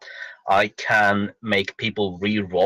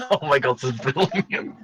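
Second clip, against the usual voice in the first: a much higher, fast laugh through the words; delighted laughing; 7 semitones higher than usual. Real, not acted. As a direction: giggle out the delight.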